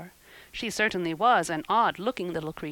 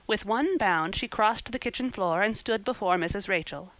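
ordinary delivery, nothing marked

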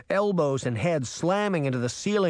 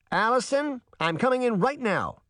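high-pitched urgent call, mildly raised; shouted; 9 semitones higher than usual. A narrator's reading. Mother shouting to her daughter behind the door, high mock yell. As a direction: yell through the locked door, pitched up but not full force. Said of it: "Sabrina shouted".

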